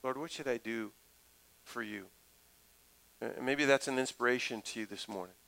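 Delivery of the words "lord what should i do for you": much softer, a hushed plea to God; hushed prayer. Shown as a demonstration. Pastor modelling a humble prayer to God, hushed. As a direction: drop it low and soft, like a private prayer. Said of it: "just to have a prayer to say".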